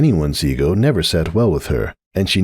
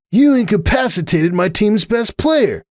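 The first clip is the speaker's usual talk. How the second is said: Hoops voice; 14 semitones higher than usual. Acted. Hoops complaining, voiced higher.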